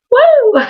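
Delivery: short high whoop through laughter; excited woo. Real, not acted. Fan pleased by a compliment on the selfie, high giggly whoop. Cheer after retelling the compliment.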